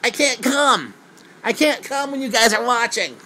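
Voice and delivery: high, loud goofy caricature; simple-minded oddball. Retold or imitated. Touched man complains to onlookers, exaggerated high protest. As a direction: protest in a hammed-up high dopey voice. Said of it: "It literally goes a little something like this".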